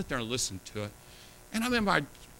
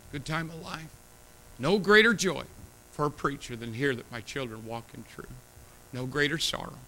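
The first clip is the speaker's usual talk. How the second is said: usual speaking voice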